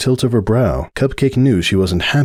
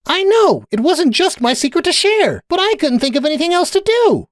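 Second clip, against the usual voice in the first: very high, agitated voice; agitated defence. A narrator's reading. Sunburst admitting it wasn't his secret, high and agitated. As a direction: burst out way up high.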